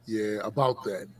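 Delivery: low slow sarcastic remark; dry sarcastic. Real, not acted.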